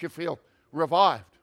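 ordinary talk

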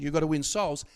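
usual speaking voice

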